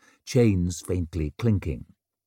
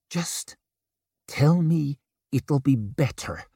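tight throat; 6 semitones higher than usual. Acted. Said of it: "Her throat felt tight".

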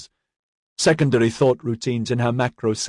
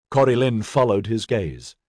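plain reading voice

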